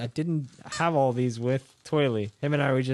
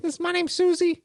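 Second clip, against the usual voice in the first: high puppet voice. Acted. Puppet introduces herself.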